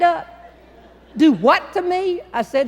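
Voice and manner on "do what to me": high, loud startled New York voice; backing away, alarmed; startled Northern accent. Retold or imitated. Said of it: "big city Northern accent".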